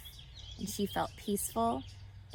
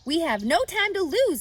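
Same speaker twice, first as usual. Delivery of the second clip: urgent; 9 semitones higher, 8 dB louder than usual. A narrator's reading. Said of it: "said Mister Zuckerman".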